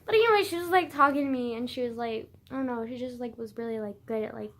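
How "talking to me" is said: usual speaking voice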